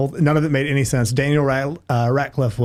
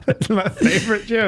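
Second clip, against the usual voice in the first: real laughter spilling into higher-pitched words; laughing; 6 semitones higher than usual. Real, not acted. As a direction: crack up at your own punchline. Cracks up after own punchline.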